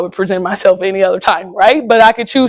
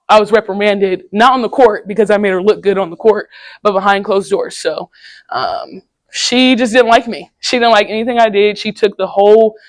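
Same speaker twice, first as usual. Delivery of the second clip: ordinary talk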